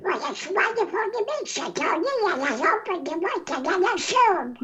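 high squawky duck voice; Donald Duck voice. Shown as a demonstration. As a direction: quack it out like Donald. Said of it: "Go ahead, let me hear you".

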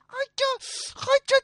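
very high pained cry; yelp of pain. Acted. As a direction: cry out as the pole hits. Li Kui hit on the leg. (被打后尖声惨叫)

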